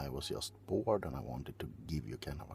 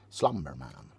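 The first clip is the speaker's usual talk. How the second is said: usual speaking voice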